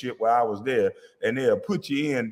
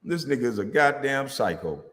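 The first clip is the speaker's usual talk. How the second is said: ordinary talk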